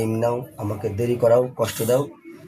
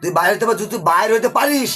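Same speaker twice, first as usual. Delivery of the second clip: raised, menacing voice; threatening; 6 semitones higher than usual. Real, not acted. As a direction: threaten the spirit forcefully. Exorcist threatens the jinn. (উঁচু ধমকের স্বরে)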